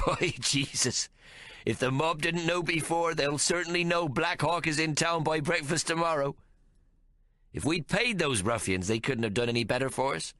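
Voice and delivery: lightly voiced amused Caleb. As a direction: relish the joke. Caleb amused after laughing.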